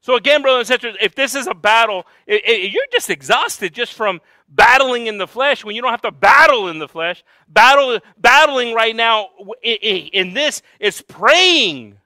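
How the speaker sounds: loud, high-pitched exhortation to the church; impassioned preaching. Real, not acted. Preacher urging the congregation to battle in prayer, impassioned shouting. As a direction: shout it out at full force, high and driving.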